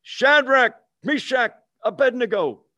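high, loud calling out of the three names; king's shout. Retold or imitated. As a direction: shout the names into the roaring furnace. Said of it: "He shouted".

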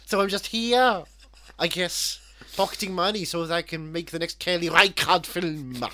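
high, loud mock Welsh accent; Welsh Michelle Williams. As Welsh Michelle Williams with no character name, in a high, loud mock Welsh accent. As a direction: put on a high, loud sing-song Welsh voice. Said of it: "everyone's Welsh in the version".

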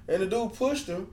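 usual speaking voice

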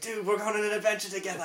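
high, quick, put-on eager voice; mock excitement. Comedian to the dude he called Sam, mock excited. Acting his banter to the dude.

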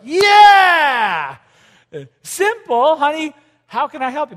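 high, loud, cheering echo of the line; mock the women erupting in applause. Women in the service cheering the pastor's line. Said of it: "They were like".

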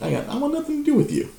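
high, prim refusal; high prim snub to the coworker; haughty dismissal. Retold or imitated. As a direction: snub him, sing-song high. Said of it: "I'm like".

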